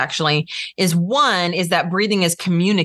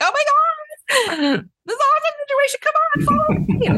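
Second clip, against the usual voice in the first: hammed-up, very high-pitched panic; frantic falsetto alarm; panicked rescuer. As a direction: squeal it in mock hysteria. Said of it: "imagine if it was an actual hostage situation".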